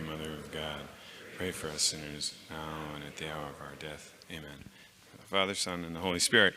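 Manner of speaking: low, hushed, reverent recitation of the Hail Mary; prayer. Real, not acted. Speaker leads the audience in a Hail Mary, low hushed prayer.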